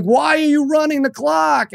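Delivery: high, accusing yell; angry dad yelling at the clock operator. Retold or imitated. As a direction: shout the question at the booth. Yelling back at the booth.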